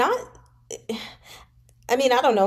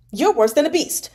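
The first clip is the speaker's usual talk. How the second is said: higher, louder countering snap; angry retort; 6 semitones higher, 5 dB louder than usual. A narrator's reading. Pan Lin retorts to Xue Xiuyi in higher angry shout. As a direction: fire the insult straight back. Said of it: "Pan Lin countered".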